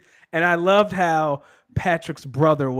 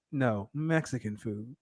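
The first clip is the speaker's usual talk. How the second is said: imitating him; 2 semitones lower, 10 dB quieter, slower than usual. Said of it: "And he says".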